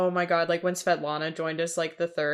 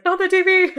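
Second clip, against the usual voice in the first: high, loud yelp through laughter; mock panic; 12 semitones higher, 9 dB louder than usual. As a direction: cry out in play-acted alarm. Imitating Lip protecting the new TV.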